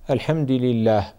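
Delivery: "الحمد لله" as al-hamdi lillah; kasra on dal. Shown as a demonstration. Sheikh shows a misreading keeping the meaning, "الحمد" said al-hamdi. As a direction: say "الحمد" with an i vowel after the d. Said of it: "بكسر الدال من الحمد".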